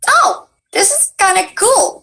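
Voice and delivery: high, slow dreamy wonder; mock naive wonder. Voice actor recalling her old thought, high and slow. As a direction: float it up like a starstruck newbie.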